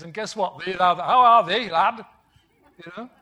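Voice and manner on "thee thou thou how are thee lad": louder, hammed-up Yorkshire greeting; a playful hint of broad Yorkshire; Yorkshire dialect. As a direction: mimic a Yorkshire local, lightly. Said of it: "preaching all around Yorkshire".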